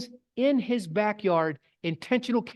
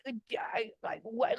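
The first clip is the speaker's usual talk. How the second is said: whispered, lost for words; breathless whisper; 11 dB quieter than usual. Real, not acted. Photographer whispering, stunned by the model's beauty. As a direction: stammer it under your breath in awe.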